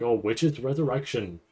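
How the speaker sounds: ordinary talk, nothing marked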